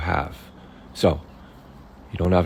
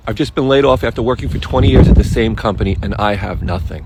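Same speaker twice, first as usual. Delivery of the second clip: loud, higher, hurried mock complaint; distressed laid-off worker; 4 semitones higher, 8 dB louder, faster than usual. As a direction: act out a client's outburst, starting loud and fast. Coach acts out laid-off client.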